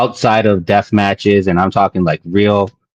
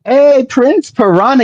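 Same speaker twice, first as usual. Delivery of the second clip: excited greeting; 3 dB louder than usual. Real, not acted. Excited greeting of a wrestler friend.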